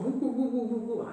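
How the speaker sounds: high-pitched mock babble of a talkative woman; mimicked yapping; imitating nonstop chatter. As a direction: run off a string of high, empty syllables. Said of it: "she can talk".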